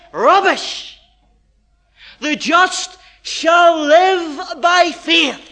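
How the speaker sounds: Paul's rebuttal. Acted. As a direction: thunder it back as Paul, slowly. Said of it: "And Paul says".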